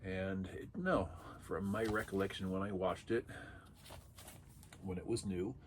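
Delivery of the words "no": normal talking voice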